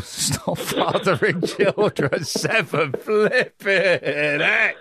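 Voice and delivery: slow, words broken up by laughter; laughing. Real, not acted. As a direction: struggle to get it out through laughing. Cracks up at caller's seven kids.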